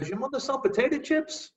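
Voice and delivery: high, mock-sweet kid's teasing call; taunting schoolkid. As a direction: sing it out like a kid setting up a joke.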